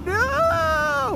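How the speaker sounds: put-on high-pitched drunken crying wail; crying like an infant. Drunk singer refusing to get off the ground, mock crying. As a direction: draw out a high, whiny mock cry. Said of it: "this is how he's acting".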